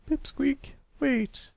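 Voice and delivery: high patient teacher voice. A narrator's reading. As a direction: speak as a kindly schoolteacher to a pupil. Miss Shirley calls him.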